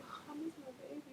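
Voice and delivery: high, soft whimper, hammed up; mock emotional whine. Pregnant mom recalls missing her kids at school, mock weepy whine. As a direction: whine it quietly, drawn high, imitating a weepy moment. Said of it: "like, really emotional".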